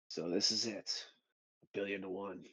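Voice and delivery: hushed heroic last words; quiet resolve. Retold or imitated. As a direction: play the hero softly facing the odds. Acts Johnny facing his last stand.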